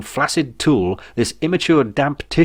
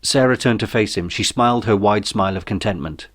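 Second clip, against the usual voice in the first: usual speaking voice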